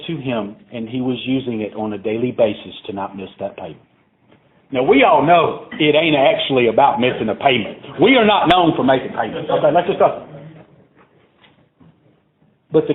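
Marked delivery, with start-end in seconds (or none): none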